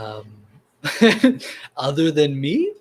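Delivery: laughing through the words. Real, not acted. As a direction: giggle while you answer. Laughs at own answer.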